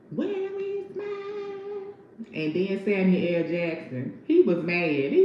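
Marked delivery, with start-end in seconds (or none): 0.1-1.8 very high, slow whiny wail; mock crying high plea; Leonardo DiCaprio impression. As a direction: stretch it out high and slow like a spoiled man. Repeating DiCaprio line.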